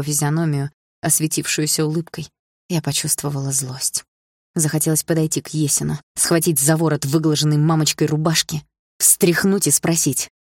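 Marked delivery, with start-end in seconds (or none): none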